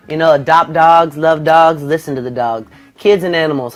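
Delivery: ordinary talk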